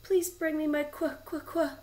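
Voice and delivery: high, loud plea breaking into stutters; acted freezing queen; shivering with cold. A narrator's reading. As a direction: stammer as if too cold to finish. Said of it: "so cold she couldn't finish her sentence".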